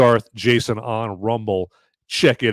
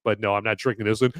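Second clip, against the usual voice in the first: normal talking voice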